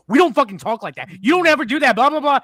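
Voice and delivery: loud, high mock New Yorker outburst; angry retort. Imitating a New York lady telling off a tourist, angry shouting. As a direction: yell it, imitating an outraged local.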